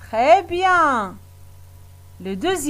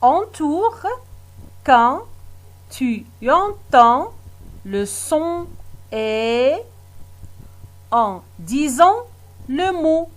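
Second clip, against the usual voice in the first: slow reading, long pauses between word groups; reading out instructions; 2 semitones higher, 3 dB quieter than usual. Shown as a demonstration. Teacher rereads the exercise instruction to pupils, piece by piece. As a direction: read it out slowly, piece by piece. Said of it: "je relis la consigne".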